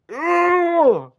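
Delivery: high, loud cry of disgust; loud grossed-out squeal; disgusted shriek. Real, not acted. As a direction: shriek it like you just got grossed out. Disgusted reaction.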